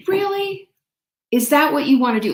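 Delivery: high, then fast self-questioning; incredulous. Retold or imitated. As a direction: question yourself in disbelief.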